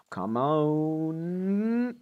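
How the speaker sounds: long, stretched-out rising build; drawn-out plea. Real, not acted. Gamer urging the roll on, rising drawn-out plea. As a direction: stretch it out, climbing in suspense.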